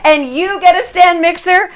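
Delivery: playful hint of a talk-show host handing out prizes; Oprah impression. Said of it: "I feel like Oprah".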